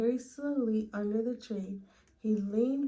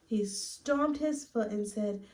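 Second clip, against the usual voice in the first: usual speaking voice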